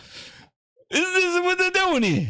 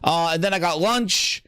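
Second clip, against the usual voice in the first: mock casual worker; 7 semitones lower than usual. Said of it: "this is not like".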